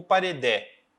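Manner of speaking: "paredé" with the final DÉ stressed and open; slow demo of "paredé", landing hard on DÉ; stress on last syllable. Shown as a demonstration. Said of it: "sílaba que eu leio de maneira mais forte".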